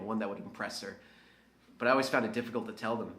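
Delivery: normal talking voice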